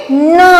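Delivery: न with the vowel hummed through the nose; nasalized vowel. Shown as a demonstration. Teacher showing children chandrabindu, nasalized न. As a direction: draw out न as a nasal नँ. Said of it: "इसका उच्चारण हम करेंगे".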